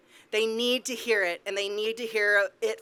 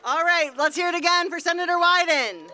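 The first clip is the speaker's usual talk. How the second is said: rally call-out; 6 semitones higher, 3 dB louder than usual. Real, not acted. Host calls crowd to cheer.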